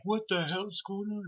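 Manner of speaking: high, slow mock yell of alarm; what's going on, mock alarmed; retold shout. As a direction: yell it like a startled neighbour. Neighbours shout at water balloons.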